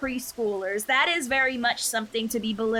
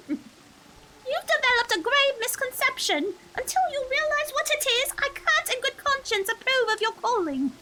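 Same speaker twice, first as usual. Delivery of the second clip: sister character voice; 14 semitones higher than usual. Acted. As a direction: voice the stern but caring sister, pitched up.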